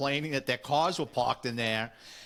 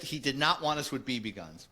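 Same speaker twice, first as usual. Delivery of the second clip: usual speaking voice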